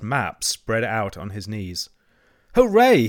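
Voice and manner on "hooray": high, loud, delighted cheer; joyful cry. A narrator's reading. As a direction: burst out cheering. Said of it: "he cried".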